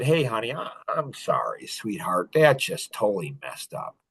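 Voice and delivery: low, soft, sheepish fatherly apology; hushed, contrite dad voice; retold apology. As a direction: apologize gently to your child, dropping low and quiet. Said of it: "I apologized to her the next day".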